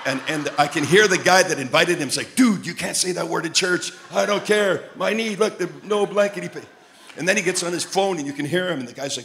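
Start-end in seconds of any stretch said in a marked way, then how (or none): 2.4-6.7 mock excited exchange. Two men arguing in church.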